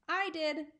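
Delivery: high proud boast. A narrator's reading. Nigel proudly admits throwing the water, high and proud. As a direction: say it puffed up with pride. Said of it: "Nigel said proudly".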